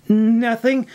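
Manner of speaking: high, hasty denial; caught-out, high-pitched innocence; quick denial. A narrator's reading. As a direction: deny it at once, voice jumping up. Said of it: "May said quickly".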